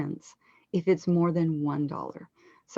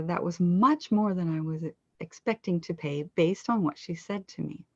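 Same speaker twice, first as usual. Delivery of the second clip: ordinary talk, nothing marked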